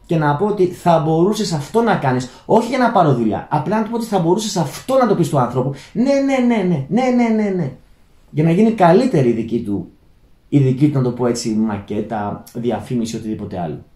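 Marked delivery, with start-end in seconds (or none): 5.9-7.7 higher-pitched mock agreement, repeated in a run; dismissive yeah-yeah. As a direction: imitate someone brushing off advice. Mimics listener brushing off his advice.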